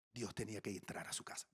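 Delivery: whispered punchline, soft and close. Real, not acted. Pastor whispering the key truth to his listeners. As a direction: drop into a hushed whisper.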